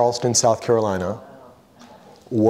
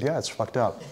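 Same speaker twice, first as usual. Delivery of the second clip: hushed agreement; 8 dB quieter than usual. Retold or imitated. Agreeing in a hush.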